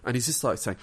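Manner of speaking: ordinary talk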